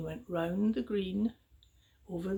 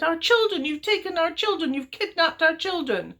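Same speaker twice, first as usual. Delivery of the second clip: accusing parents; 8 semitones higher, 6 dB louder than usual. A narrator's reading.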